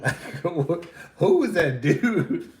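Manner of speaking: laughing in disbelief. Real, not acted. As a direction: ask it while cracking up.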